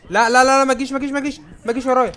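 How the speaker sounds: very high, loud frantic pleading; panicked screaming. Real, not acted. Gamer begging a monster chasing him not to follow, panicked screams. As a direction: scream no as it chases you.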